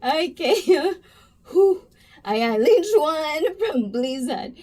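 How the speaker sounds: real laughter fading; recovering from laughter. Real, not acted. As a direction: push through the last of the laughs. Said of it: "this is serious".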